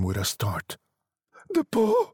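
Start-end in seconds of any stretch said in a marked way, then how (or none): 1.5-2.1 very high, wild outcry; wild cry. A narrator's reading. Wife waking her husband with a wild cry. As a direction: cry out wildly, high-pitched. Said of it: "she cried wildly".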